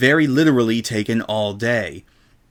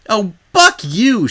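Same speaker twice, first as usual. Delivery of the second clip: higher, louder, slow angry yell; 9 semitones higher, 5 dB louder, slower than usual. Acted. Rainbow yells at the shop owner, furious. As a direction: spit it out in fury. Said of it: "she yelled at the shop owner".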